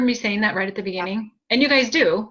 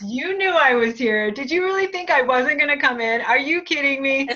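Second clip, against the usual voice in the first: higher, louder teasing sister voice; playful mock indignation, pitched up; sassy sister; 6 semitones higher, 3 dB louder than usual. Medium voicing sister's playful scolding, higher and louder. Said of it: "She goes".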